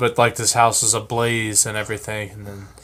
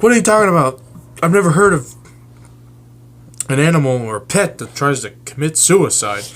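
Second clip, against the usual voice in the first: a bit higher and louder, puzzled; lightly voiced owner; 6 semitones higher, 5 dB louder, much faster than usual. Lester questions his suicidal bird, slightly higher, puzzled voice.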